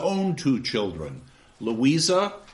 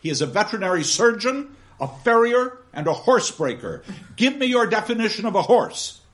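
stern schoolmaster; 7 semitones higher, 4 dB louder than usual. Acted. Gradgrind rattles off, demands.

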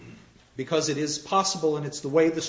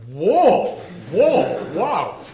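loud, higher gasps of wonder; amazed audience; 5 semitones higher, 9 dB louder, much slower than usual. Retold or imitated. Audience reacting to the happy ending, loud and amazed. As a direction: marvel out loud. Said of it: "you're sitting there going".

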